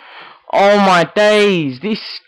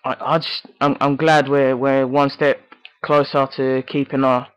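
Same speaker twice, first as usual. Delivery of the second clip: subdued mumble; 6 semitones lower, 5 dB quieter than usual. Real, not acted. Muttering relief at half time.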